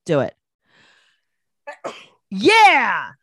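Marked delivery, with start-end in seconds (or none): 2.3-3.1 loud real sneeze; sneezing. Real, not acted. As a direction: let a big sneeze out. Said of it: "I made it out".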